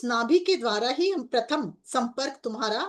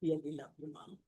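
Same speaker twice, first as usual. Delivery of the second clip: slow, softer, tender and heartfelt; tender devotion; 3 dB quieter, much slower than usual. Real, not acted. Devotee speaking softly to the divine mother, tender. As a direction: murmur your love slowly to her.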